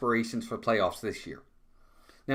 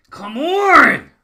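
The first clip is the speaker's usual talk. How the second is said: loud, high cry of exasperation; frustrated shout; 13 semitones higher, 12 dB louder than usual. Real, not acted. Fan watching the inning end, shouting in frustration. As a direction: let the whole blown chance out in one yell. Inning ends with bases loaded.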